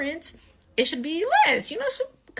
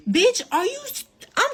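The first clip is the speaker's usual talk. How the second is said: sudden high, louder snap of outrage; angry outburst; 11 semitones higher, 5 dB louder than usual. Real, not acted. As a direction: blurt it out furious, then cut yourself off. Cuts off the clerk angrily.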